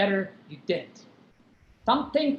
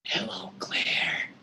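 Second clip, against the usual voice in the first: hushed whispered hello; 8 dB quieter, much slower than usual. Acted. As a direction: breathe it, unvoiced. Masked intruder whispers.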